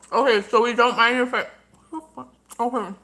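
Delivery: talking while chewing; words round a bite of food; mouth full. Real, not acted. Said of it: "I hope you don't mind if I talk with my mouth open".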